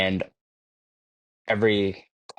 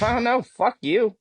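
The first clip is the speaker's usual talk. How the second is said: high, incredulous brush-off; mock indignation; 12 semitones higher than usual. Answering a friend who asks about Russia, high and incredulous. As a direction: toss it off like an absurd offer.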